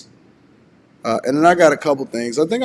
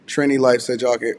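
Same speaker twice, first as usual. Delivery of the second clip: normal talking voice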